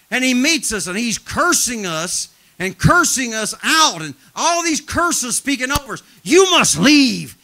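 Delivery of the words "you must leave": loud, drawn-out demon command, imitated; mock a demon ordering people out; hammed-up demonic order. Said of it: "And there's a demonic voice".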